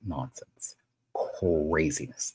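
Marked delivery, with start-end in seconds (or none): 0.1-0.8 whispering. Real, not acted. Aside about online nonsense.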